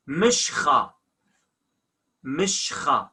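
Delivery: mishcha with a rasped kh after sh; spoken kh. Shown as a demonstration. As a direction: say mishcha with a throaty ch. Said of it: "But we say mishcha".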